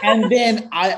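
high, loud words through laughter; real laugh spilling into speech. Real, not acted. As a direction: carry on talking while still laughing. Laughing after own joke.

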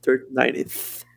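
Third, Ninth with the tongue between the teeth; Third, Ninth with a careful English th. Shown as a demonstration. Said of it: "Tem que fazer a linguinha".

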